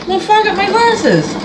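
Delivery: real tears breaking through, pitch leaping up; choking up. Real, not acted. Vlogger choking up over the quilt designer's death, tearful. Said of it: "why am I getting so emotional".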